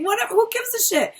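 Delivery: high, careless whatever; mock carefree shrug. Cheater brushes off critics, careless and high. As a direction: toss it off like nobody cares.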